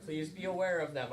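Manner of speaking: usual speaking voice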